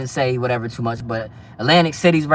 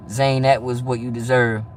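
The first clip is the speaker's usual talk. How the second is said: usual speaking voice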